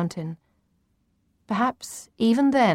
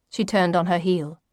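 ordinary delivery

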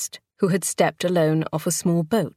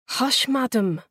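a fussing, higher-voiced keeper's wife; 6 semitones higher than usual. A narrator's reading. Keeper's wife hushing the labouring gentlewoman, fussing. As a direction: hush her like a fretful housekeeper. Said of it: "Effie the keeper's wife fussed".

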